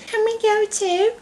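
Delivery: put-on very high, soft little girl voice; high childish request; pleading Bridget. A narrator's reading. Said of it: "pleaded Bridget".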